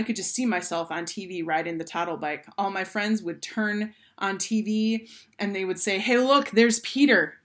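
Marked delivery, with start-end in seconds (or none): 6.0-7.4 loud, high thrilled call; excited exclamation. A narrator's reading. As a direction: call friends over to see. Imagined friends spotting him on TV.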